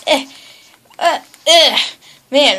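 normal talking voice

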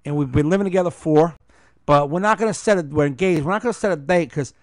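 loud, fast Jersey guy; Jersey accent. Retold or imitated. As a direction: play up the Jersey East Coast twang. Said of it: "he's like".

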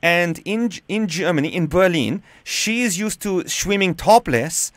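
hammed-up German accent, deliberate and emphatic; badly faked German caller; put-on German accent. Shown as a demonstration. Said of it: "with a German accent".

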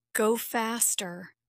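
plain reading voice

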